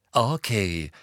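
mildly irritated acknowledgement; annoyed. A narrator's reading. Harry turning on Luna, annoyed. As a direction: concede it with annoyance. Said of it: "erwiderte Harry ärgerlich".

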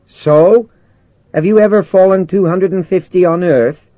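very high, loud protest; indignant challenge. Acted. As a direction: throw the question back at the commander. Jimmy argues against jumping.

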